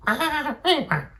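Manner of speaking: high, loud bird squawk; Red Bird impression. Gamer opens his Angry Birds video, Red Bird impression. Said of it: "tried to do the Red Bird impression".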